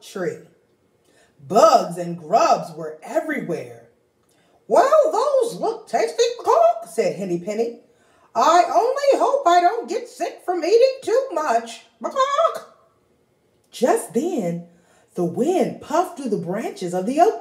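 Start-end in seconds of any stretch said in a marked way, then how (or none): 4.7-12.8 high, fretful hen voice, tail word squawked; fussing anxious little hen; worried hen. Acted. Henny Penny fretting to Ducky Lucky about the weather, high and fussy.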